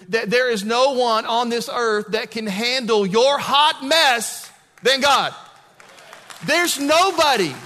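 preaching climax. Acted. Preacher urging the congregation that God forgives them, building intensity. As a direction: build the promise slowly and loudly.

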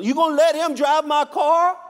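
hammed-up husband getting indignant; mock outrage. Husband escalates in joke.

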